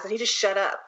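normal talking voice

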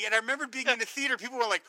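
normal talking voice

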